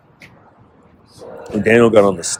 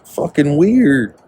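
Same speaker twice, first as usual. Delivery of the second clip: mocking repeat; 6 semitones higher, much slower than usual. Real, not acted. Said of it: "repeat of line 133".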